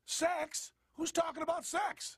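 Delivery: mock surprise. Feigned innocent surprise.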